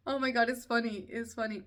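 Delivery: loud burst through real laughter; amused, laughing. Real, not acted. Mother laughing at her own re-enacted scream, amused. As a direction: say it while recovering from laughing.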